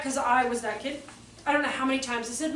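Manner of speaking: ordinary delivery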